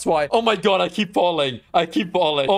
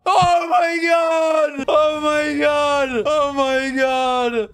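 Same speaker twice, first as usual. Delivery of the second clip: excited shouting; 6 semitones higher, 3 dB louder, much slower than usual. Real, not acted. Reaching the summit area.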